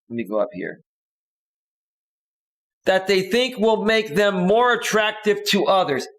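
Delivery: slow emphatic reread. Shown as a demonstration. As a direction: read it again slowly to drive it home. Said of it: "Let me read that one more time".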